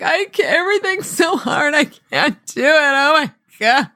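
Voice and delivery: high, hammed-up despairing wail; imitated sobbing. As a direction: mimic a child sobbing that it's all too much. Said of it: "sobbed and sobbed and sobbed".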